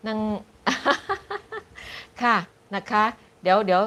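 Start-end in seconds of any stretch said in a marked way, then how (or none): 0.6-2.2 high-pitched giggle; laugh. Real, not acted. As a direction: burst of high laughter while reading. Laughs while reading a comment.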